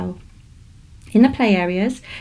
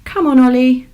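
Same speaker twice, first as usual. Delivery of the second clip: doctor calling; 4 semitones higher, 7 dB louder than usual. Acted. Doctor calls Ollie.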